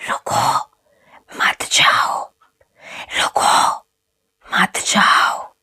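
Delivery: soft ghostly whisper like stones rubbing together; whispered ghost call; ghostly grinding voice. Acted. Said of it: "ऐसी थी जैसे दो पत्थर आपस में रगड़ रहे हों".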